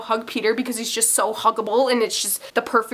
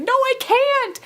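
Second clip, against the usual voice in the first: high-pitched panicky protest; retold nervous squealed refusal; panicked refusal; 14 semitones higher, much slower than usual. Acted panicked refusal to friend.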